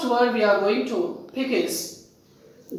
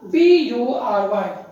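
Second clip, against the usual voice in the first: ordinary delivery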